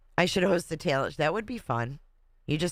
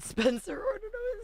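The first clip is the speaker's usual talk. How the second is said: laughing; 4 dB quieter than usual. Real, not acted. Laughing at cat story.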